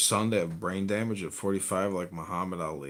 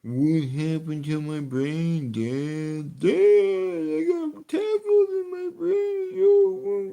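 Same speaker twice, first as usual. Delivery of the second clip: very high, slow crying whine; brain-damaged son; 5 dB louder, slower than usual. Acted. Comic playing the injured son to his friend, high slow crying whine. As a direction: wail like a dazed hurt kid.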